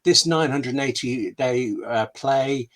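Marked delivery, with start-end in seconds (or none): none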